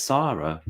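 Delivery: "Sarah" said with a long ah, as SAH-ruh; open-vowel Sarah. Shown as a demonstration. Correcting how the name is said, "Sarah" as SAH-ruh. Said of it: "you would say Sarah".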